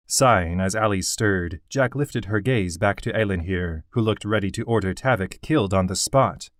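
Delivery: plain reading voice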